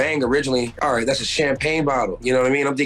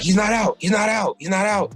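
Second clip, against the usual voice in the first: high excited yell, imitating the uncle; retold shout; 9 semitones higher than usual. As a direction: uncle at the bar shouting the attacker isn't out yet, high yell. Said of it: "He's like".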